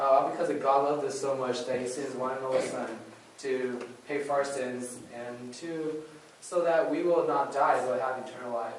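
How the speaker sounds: ordinary delivery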